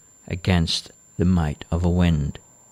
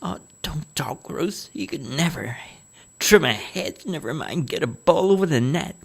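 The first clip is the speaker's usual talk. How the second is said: scornful; 8 semitones higher than usual. A narrator's reading. Mother scoffs at gardener.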